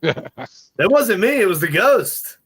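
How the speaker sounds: lightly played ghost hunter covering a fart; mock guilty investigator. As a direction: pretend to blame it on the ghost. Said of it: "something you would say".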